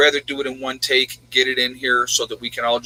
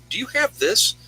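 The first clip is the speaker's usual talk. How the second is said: higher, mock questioning voice; imitating family asking; 12 semitones higher than usual. Family asking the prepper, in a high imitating voice. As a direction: lightly mimic someone asking for supplies. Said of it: "they'll come to me".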